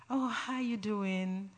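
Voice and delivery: slow, hushed imitation of gentle American speech; soft American talk. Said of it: "they say it so softly, so gentle".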